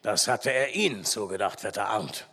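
low grumbled remark; gruff, low character voice; grumbling forester. A narrator's reading. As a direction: grumble on in the old forester's deep voice. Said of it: "brummte der Förster".

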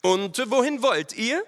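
high, pressing interrogation; stern officer. A narrator's reading. Lieutenant interrogates riders about their route, stern. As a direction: question them as a commanding officer.

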